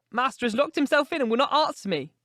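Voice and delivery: put-on high, anxious old-woman voice; distressed old housekeeper. A narrator's reading. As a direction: voice a worried housekeeper, pitched high.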